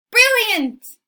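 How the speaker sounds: high, loud burst of delight; delighted exclamation. Retold or imitated. Pope marvelling at the circle, loud delight. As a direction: exclaim in sheer admiration.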